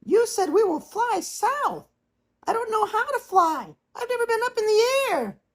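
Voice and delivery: put-on very high, small voice of a young swan; cygnet brother. A narrator's reading. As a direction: call out like a little cygnet. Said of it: "one of his brothers spoke up".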